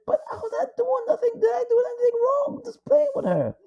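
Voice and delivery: put-on boy crying that he did nothing wrong; childlike plea. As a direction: play a scared boy protesting innocence through tears. Emmett voiced, pleading, story.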